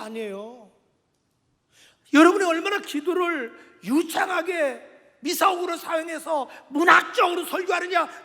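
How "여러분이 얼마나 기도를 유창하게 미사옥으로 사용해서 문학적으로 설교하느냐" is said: loud pulpit voice climbing to a shouted close; impassioned preacher, forceful and insistent; fervent preaching. Real, not acted. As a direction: drive the point home at full force.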